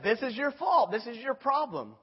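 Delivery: loud, high-pitched blaming; mock accusation. Israel coming to Moses with blame, acted accusation.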